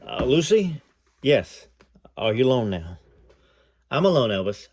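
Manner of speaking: reading a memoir aloud, lightly voicing the callers; story narration. A narrator's reading. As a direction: read it like telling the story to an audience. Reads memoir aloud to viewers.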